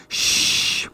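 long, soft shush; soft quiet-time shush; hushing shh. A narrator's reading. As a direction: hush her with a drawn-out shh.

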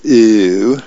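drawn-out, grossed-out "ew"; comic-strip dad recoiling; disgusted dad. A narrator's reading. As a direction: react with mock disgust. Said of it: "And Dad goes".

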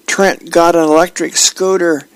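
put-on high-pitched little boy bursting with news; excited yelling kid. A narrator's reading. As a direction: gush it like a thrilled child, pitch way up. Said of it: "Hammy comes rushing in to the room and yells".

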